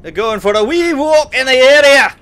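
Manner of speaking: loud, high-pitched bad Scottish accent; hammed-up Scottish lilt, pushed loud; mock Scottish accent. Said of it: "apologise to my Scottish viewers".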